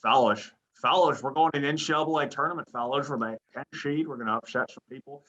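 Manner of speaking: slurred coach pep talk, esses turned to sh; rally the fellas in a hammed-up coach voice; Dana Altman. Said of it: "that is a really good Dana Altman impression".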